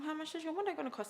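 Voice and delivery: hurried mock query; quick question. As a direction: rattle it off, suspicious. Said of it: "And I was like".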